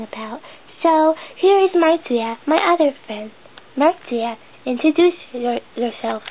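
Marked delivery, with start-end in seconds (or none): none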